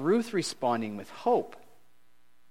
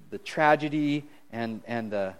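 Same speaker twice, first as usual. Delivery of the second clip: ordinary talk, nothing marked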